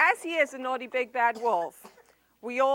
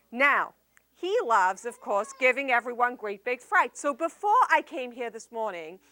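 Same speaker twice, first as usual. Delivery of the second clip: usual speaking voice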